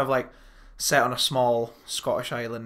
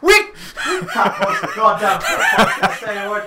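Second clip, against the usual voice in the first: high, loud, incredulous yelling; exasperated outburst; 9 semitones higher, 7 dB louder than usual. Real, not acted. Host realising Trainspotting was forgotten, loud exasperated outburst. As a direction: explode at your own oversight.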